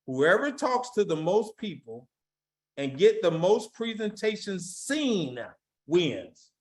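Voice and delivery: measured, emphatic dictation pace; slow reading. Shown as a demonstration. Speaker dictating a key statement for recruiters to write down, slow reading. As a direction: read it out slowly, spacing the words. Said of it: "I'm gonna read it slow".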